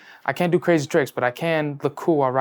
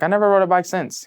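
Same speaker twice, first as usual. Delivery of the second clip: higher, louder retold kid voice; sheepish confession to dad; 6 semitones higher, 5 dB louder than usual. Boy tells his dad about biking, mock distressed. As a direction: admit it in an exaggerated kid's plea.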